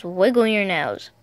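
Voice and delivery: plain reading voice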